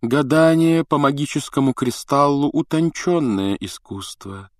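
high, slow, drawn-out singsong. A narrator's reading. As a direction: draw the vowels out like a mystic teacher chanting. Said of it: "говорила она нараспев". (нараспев, тягуче)

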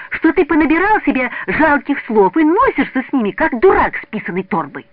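very high, loud tirade; angry shout. A narrator's reading. Петя yells his mother's words at Мазин, angry shout. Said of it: "закричал он в лицо товарищу".

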